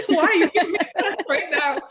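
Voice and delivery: high, loud, laughing through the words; laughing protest. Retold or imitated. Fighter laughing back at her coach mid-fight, high and loud. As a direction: laugh out the complaint.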